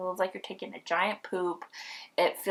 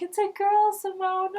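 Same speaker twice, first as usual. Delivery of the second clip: happy announcement; 13 semitones higher, 6 dB louder than usual. Retold or imitated. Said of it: "But he was like".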